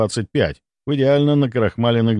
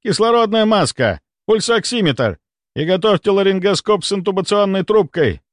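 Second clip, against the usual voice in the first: high, urgent list of commands; barked orders; 8 semitones higher than usual. A narrator's reading. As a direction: bark out equipment to a junior. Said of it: "рявкнул Мельников на девушку".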